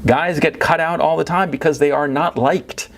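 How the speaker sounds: usual speaking voice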